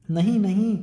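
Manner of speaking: put-on a boy pulling free, crying no; frightened protest. Oliver resists being dragged. (डरा हुआ इनकार)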